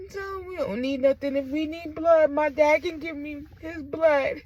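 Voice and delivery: holding back a cry; tearful refusal. Retold or imitated. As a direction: voice high and choked with tears. Tearful retelling of refusing family's help.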